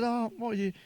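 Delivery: high, curious delight; light up at the compliment; eager wife. Retold or imitated. Wife answers his text with high eager curiosity.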